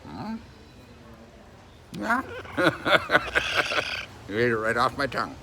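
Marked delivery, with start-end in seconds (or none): 2.3-4.2 breathy laugh. Real, not acted. Laughs at pet.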